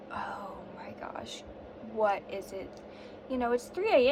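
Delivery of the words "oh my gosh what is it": hushed whisper, very slow. Retold or imitated. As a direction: whisper so you don't wake him. Dogs crying at 3 AM.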